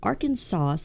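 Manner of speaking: Arkansas drawn out with a Southern twang; Southern accent. Shown as a demonstration. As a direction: stretch Arkansas in a Southern drawl. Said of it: "I'm saying it like a northerner".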